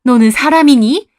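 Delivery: loud, high-pitched angry tirade; furious scolding. A narrator's reading. Mother-in-law scolds Heeju for wrecking the marriage, furious shouting. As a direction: lay into her at full volume. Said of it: "희주를 노려보며 호통을 치시더라고요".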